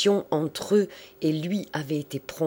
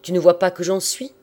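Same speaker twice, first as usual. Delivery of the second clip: loud, higher indignant challenge; booming outburst; 4 semitones higher, 8 dB louder than usual. A narrator's reading. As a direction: throw it loudly at the usher. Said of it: "avec un éclat de voix". (réplique forte et indignée)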